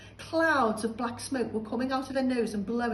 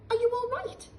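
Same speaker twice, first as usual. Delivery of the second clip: much higher, mock polite; polite boy; 3 dB louder than usual. George asking stunned grandma, mock polite. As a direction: ask sweetly, as if innocent.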